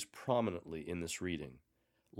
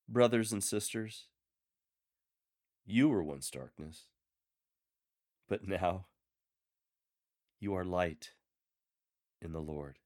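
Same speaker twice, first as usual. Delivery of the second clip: scripture reading. Shown as a demonstration. Lector modelling the reading for a congregation, intimate and measured. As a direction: read it like a personal word to one listener.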